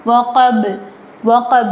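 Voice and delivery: وقب with a bouncing final b; echoing b. Shown as a demonstration. Teacher showing the qalqala bounce on the b. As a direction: pop the b at the end of وقب. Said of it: "اس پر ہم قلقلہ کرتے ہیں".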